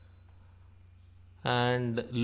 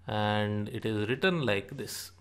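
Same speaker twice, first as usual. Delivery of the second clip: normal talking voice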